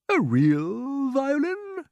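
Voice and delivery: high, drawn-out exclamation; indignant cry. A narrator's reading. Grasshopper answering James's praise, crying out astonished. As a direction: echo it in high, slow astonishment. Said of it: "the old green grasshopper cried".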